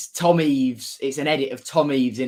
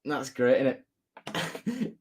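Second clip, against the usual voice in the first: mock Scouse accent; 7 dB quieter, much slower than usual. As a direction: tease in a light Scouse accent. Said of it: "making fun of Scouse accent".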